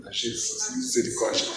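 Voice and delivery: low, hushed sigh of a plea; dismayed muttered plea. Retold or imitated. Preacher privately begging Jesus for mercy, hushed dismay.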